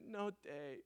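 put-on soft, sleepy little-kid refusal; a hint of a grumpy toddler; imitating tired toddler.

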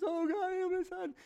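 very high, loud begging cry; desperate cry. Retold or imitated. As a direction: plead at the top of your voice. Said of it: "wanting this thing so much".

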